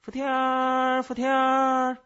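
high, loud, long-drawn insect call; shrill out each cry like a cicada, stretched and held high. A narrator's reading. Pet cicada calls its own name, piercing drawn-out cry.

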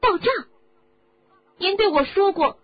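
high, loud stunned questions; shocked boy. Acted. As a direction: cry out in disbelief. Harry shocked by the truth.

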